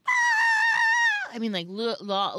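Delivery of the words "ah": very high, loud mock scream; piercing imitated tantrum scream; child's scream. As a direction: shriek like a toddler losing it. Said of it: "losing her mind".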